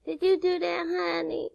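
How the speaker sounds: put-on high, sweet baby-talk to a toddler; grandma cooing at her one-year-old grandson. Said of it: "I talk to you like I do my grandson".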